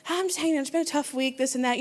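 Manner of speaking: understated, weary honesty; subdued admission. Retold or imitated. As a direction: admit it quietly to a friend. Acting out honest, subdued confiding.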